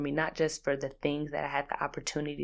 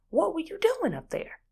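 much higher, stretched doing; incredulous high question; 12 semitones higher than usual. Shown as a demonstration. Demanding answer from husband, pitched high in disbelief.

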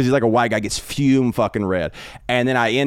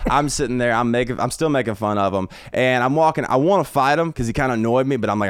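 ordinary talk, nothing marked

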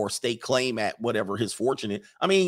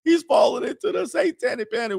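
much higher, shouting to the Lord; frantic church mother; 9 semitones higher than usual. Retold or imitated. Mother shouting prayers against satanic panic, frantic high shout. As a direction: cry out in a holy panic. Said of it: "She was like".

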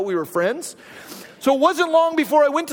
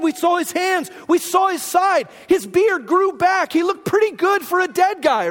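higher, breathless rush of good news; excited disciples; 6 semitones higher than usual. Retold or imitated. Disciples telling Thomas about Jesus, excited and high. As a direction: burst with the news, you can't hold it in.

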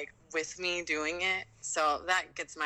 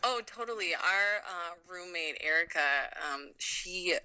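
ordinary talk, nothing marked

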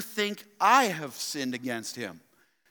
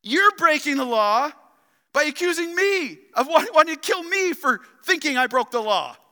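fervent preaching; 9 semitones higher, 6 dB louder than usual. Acted. Preacher driving the point.

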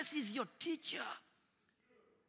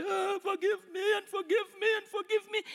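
higher, imitated begging repentant voice; mock pleading; 9 semitones higher than usual. Preacher mocking believers who beg forgiveness. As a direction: play a pleading penitent, lightly. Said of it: "we go on and repent and cry".

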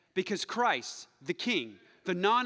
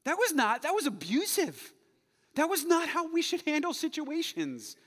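realization; 8 semitones higher, 4 dB louder than usual. Retold or imitated. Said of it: "I read this and I was like".

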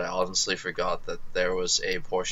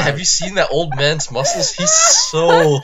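high, giddy, laughing through it; laughing gush; 10 semitones higher, 8 dB louder, slower than usual. Real, not acted. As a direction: swoon over the muscles while cracking up. Gushing over Bumi's muscles, laughing.

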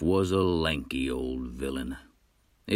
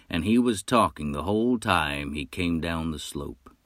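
ordinary delivery

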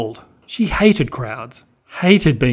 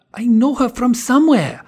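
higher character voice, puzzled; inner thoughts of Helmut; 11 semitones higher than usual. Acted. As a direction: wonder it to yourself. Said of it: "he thought to himself".

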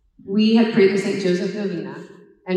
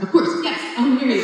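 excited yes; 5 semitones higher, 4 dB louder, faster than usual. Retold or imitated. Accepting proposal, laughing.

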